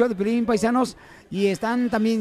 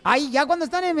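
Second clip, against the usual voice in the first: ordinary delivery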